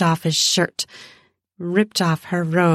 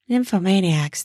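usual speaking voice

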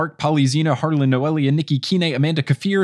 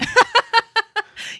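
short laugh, much higher. Real, not acted. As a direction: laugh off the joke. Laughs at own joke.

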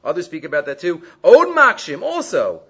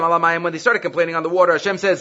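ordinary talk, nothing marked

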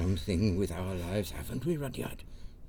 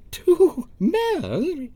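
high, loud horrified gasp of a question; aghast at the very idea; scandalised cry; shocked; 10 dB louder than usual. Acted. Mayor shocked at two mayors.

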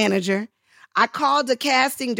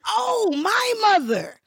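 high, drawn-out oh of realization; acted-out surprise, pitched up; sudden realization; 11 semitones higher than usual. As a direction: it dawns on you, slow and high. Acts her sudden realization.

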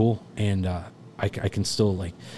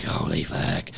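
whispered awe; 5 semitones lower, slower than usual. Retold or imitated. Inner reaction as fire starts.

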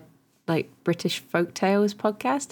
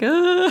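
disgusted groan; 10 semitones higher, 5 dB louder than usual. Retold or imitated. Recoiling at a spooky podcast.

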